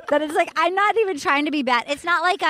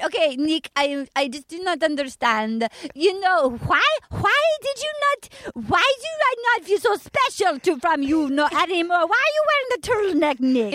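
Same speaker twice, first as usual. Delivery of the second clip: put-on French accent gone Russian; 3 semitones higher than usual. Contestant complaining to her bachelor, hammed-up French-Russian accent.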